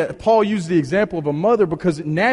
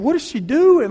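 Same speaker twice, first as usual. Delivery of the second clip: alarmed; 10 semitones higher than usual. Retold or imitated. Retold alarm at rough nurse.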